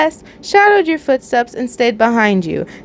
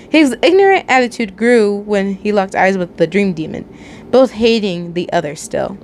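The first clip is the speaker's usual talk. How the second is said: plain reading voice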